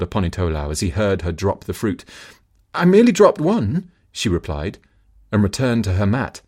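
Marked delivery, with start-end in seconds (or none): 2.7-3.9 calling back. Acted. Wife calls back, covering up.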